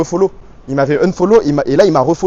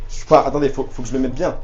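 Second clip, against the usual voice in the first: ordinary talk, nothing marked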